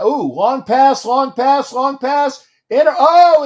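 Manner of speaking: rising hype as the ball flies; excited play-call. Real, not acted. Live football, long pass in air.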